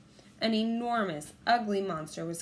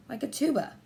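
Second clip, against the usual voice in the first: plain reading voice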